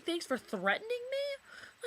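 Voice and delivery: high, slow, incredulous mock repeat; sarcastic mimicry of the boyfriend's text; mocking disbelief. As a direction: throw his words back in scorn. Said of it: "he's sitting here saying".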